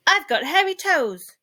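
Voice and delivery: loud, high cheerful shout. A narrator's reading. Ike shouting to the giraffe from a branch. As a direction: belt it out like a proud little monkey. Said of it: "shouted Ike".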